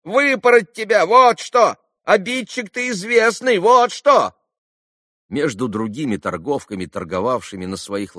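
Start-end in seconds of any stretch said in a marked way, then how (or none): 0.0-4.3 high, loud scolding with punched endings; indignant yelling. A narrator's reading. Trader threatening a known little bully, yelling. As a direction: shout threats at a naughty boy. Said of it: "раскричалась Марья".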